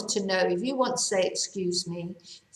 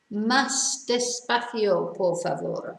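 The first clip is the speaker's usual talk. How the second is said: slow model phrase; slower than usual. Shown as a demonstration. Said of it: "teacher models the phrase slowly".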